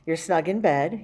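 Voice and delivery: plain reading voice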